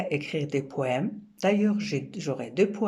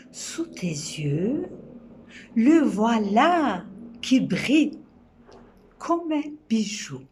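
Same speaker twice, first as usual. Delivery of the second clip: poem recitation. Acted. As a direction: recite it gently, letting each image land. Recites her poem Comprendre.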